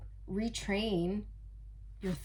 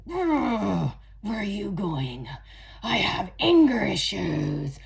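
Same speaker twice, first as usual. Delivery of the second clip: slow, louder menacing voice; angry swordsman; 5 dB louder, slower than usual. Pretend. The sword-raising man on the card, slow and loud. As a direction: growl it like a hothead.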